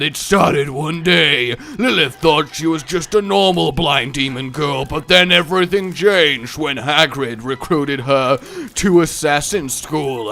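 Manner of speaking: slow, dramatic trailer narrator; mock blockbuster voiceover with long pauses; movie trailer voice. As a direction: sell it like an epic film trailer.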